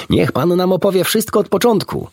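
high eager pleading; excited plea. A narrator's reading. Animals begging the doctor for the story, high and eager. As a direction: beg him to start from the beginning. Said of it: "wykrzyknęło całe towarzystwo".

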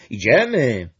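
loud eager shout. Acted. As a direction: shout it ahead to the host. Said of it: "krzyknął Gluś". (Gluś głośno krzyczy do Brąby)

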